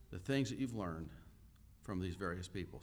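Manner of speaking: ordinary talk, nothing marked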